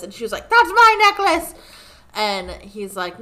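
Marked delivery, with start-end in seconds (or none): 0.5-1.5 much higher, loud outraged claim; hammed-up shocked protest; indignant cry. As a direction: shout it as if robbed. Katara claiming her necklace.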